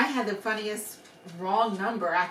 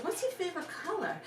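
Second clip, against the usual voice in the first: playful question; 8 semitones higher, 10 dB quieter than usual. Retold or imitated. Disarming question to upset caller.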